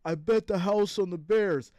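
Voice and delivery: lightly voiced complaining bettor; a hint of a sulky fan; mildly mimicked losing gambler; mock whiny viewer. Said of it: "Some of you will hit me up like".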